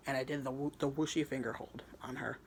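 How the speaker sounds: usual speaking voice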